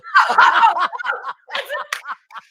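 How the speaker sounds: high cackle; loud laugh. Real, not acted. Comedian laughing at his sperm bank joke. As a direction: crack up at the punchline.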